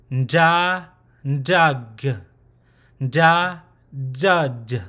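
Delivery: "nda, ndug" with a nasal before the main sound; nasal-onset consonant. Shown as a demonstration. Teacher presenting number eighteen, demonstrated nasal-onset pronunciation. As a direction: open "nda", then closed "dud", each separate. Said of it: "Now for number eighteen, it'll be".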